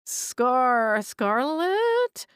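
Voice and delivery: stammered, rising high in disbelief; halting, then climbing up in shock; stunned disbelief. A narrator's reading. Ghost stunned to see her.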